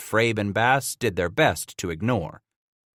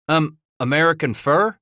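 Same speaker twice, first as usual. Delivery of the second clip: uneasy questioning rise; worried repeat; 7 semitones higher, 4 dB louder than usual. A narrator's reading. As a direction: echo the words with dawning worry. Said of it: "Bass repeated with worry".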